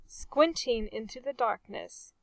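ordinary delivery

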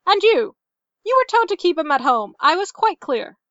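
high, loud, rapid rebuke; sharp scolding. A narrator's reading. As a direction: mistress turning on a servant, snapping fast. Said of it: "before turning on Bentu".

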